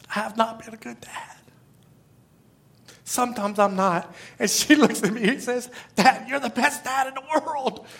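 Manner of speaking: choked up, soft, halting voice with long pauses; crying. Real, not acted. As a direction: speak softly through real tears. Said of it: "Sorry, I'm crying again".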